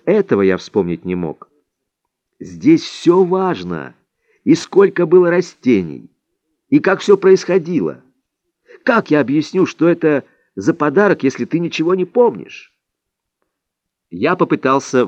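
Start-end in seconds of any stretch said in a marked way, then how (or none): 2.4-12.7 higher-pitched, emphatic demand; insistent. A narrator's reading. Don Juan demanding details of the plants, insistent. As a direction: press each point with a lifted pitch.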